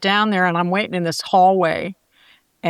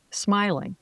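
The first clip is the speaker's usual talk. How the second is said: ordinary delivery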